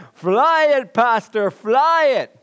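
high, very loud frenzied cheer; crowd going wild. Acted. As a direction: scream encouragement like a wild congregation. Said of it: "And the crowd goes wild".

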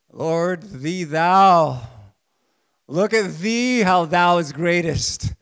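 loud, hammed-up King James prayer; mock pompous prayer. Preacher mocking showy old-fashioned prayer, loud and pompous. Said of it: "You think God wants to hear me talk like that?".

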